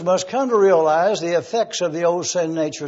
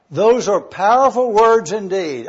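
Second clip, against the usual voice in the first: usual speaking voice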